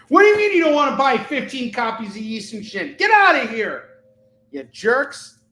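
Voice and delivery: high, loud put-on voice scolding the buyers; hammed-up huff at buyers who won't buy; mock rage selling. Comic seller mock-scolds viewers who won't buy, hammed-up huffy voice. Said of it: "rage selling translates well".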